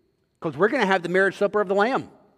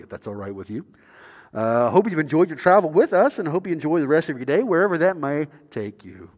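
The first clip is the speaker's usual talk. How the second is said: calm pilot announcing descent; airline pilot voice; 6 semitones lower than usual. Retold or imitated. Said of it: "the pilot comes on the radio, he's like".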